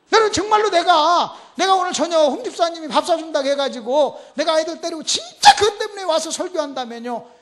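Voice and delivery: high, loud sermon appeal; impassioned preaching. Real, not acted. Preaches at full force.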